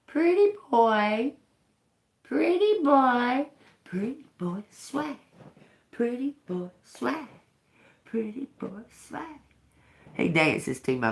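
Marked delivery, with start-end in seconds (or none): 0.1-3.5 very high, slow sing-song; bird-talk voice. Pretend. Talking to the bird. 3.8-9.4 very high, soft sing-song; soft bird-talk coo. Pretend. Cooing to the bird.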